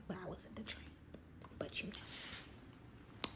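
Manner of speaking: cheeky whispered aside to the audience; hushed, knowing aside. Real, not acted. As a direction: drop to a secret whisper.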